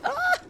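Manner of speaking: sudden shrill high scream; very high shriek. Real, not acted. Startled player shrieking during a sea fight. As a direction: let out a squeak at the top of the voice. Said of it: "my voice could go that high".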